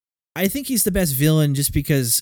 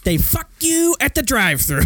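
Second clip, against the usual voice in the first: Leo Getz impression; 11 semitones higher than usual. Quoting Leo Getz line.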